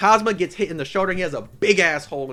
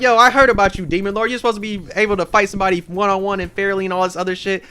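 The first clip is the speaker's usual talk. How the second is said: louder, higher mock outrage that fades to a steadier rant; indignant call out; 5 semitones higher, 6 dB louder than usual. [name] scolding the Demon Lord about fair fights, loud mock outrage.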